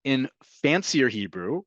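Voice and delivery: normal talking voice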